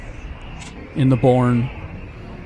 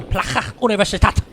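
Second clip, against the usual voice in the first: Plaza Universitat in a higher, put-on German accent; accidental German accent; 7 semitones higher than usual. Shown as a demonstration. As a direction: slip into a mock German accent for Catalan. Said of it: "say it with a German accent".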